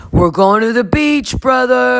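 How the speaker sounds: loud, high bro cheer; mock-stoked beach yell; hyped shout. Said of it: "And Carl's like".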